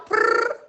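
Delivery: high trilled cat purr; playful purr. Pretend. Streamer cheering like a cat, high trilled purr. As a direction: roll a high "prrr". Imitates a cat after meow.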